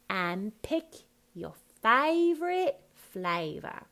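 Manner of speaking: slow, high "favorite" without t; dropped T. Shown as a demonstration. Coach modelling the line, dropped T. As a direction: FLAVOR as flayva, loud. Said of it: "no T on favorite".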